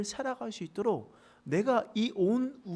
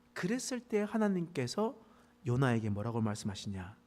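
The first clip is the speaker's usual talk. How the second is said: ordinary talk, nothing marked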